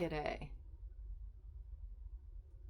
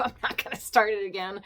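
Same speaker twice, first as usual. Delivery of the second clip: louder insistence; 3 semitones higher, 10 dB louder, faster than usual. Real, not acted. Repeats louder.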